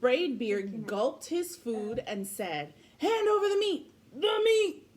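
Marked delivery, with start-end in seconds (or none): 3.0-4.8 high, rowdy pirate roar. Acted. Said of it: "we all roared".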